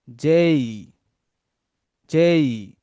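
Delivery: "যেই" spoken with a clear ay glide, drilled twice. Shown as a demonstration. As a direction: model "যেই" for repetition. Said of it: "তারপর দেখেন যেই". ("যেই" স্পষ্ট উচ্চারণ)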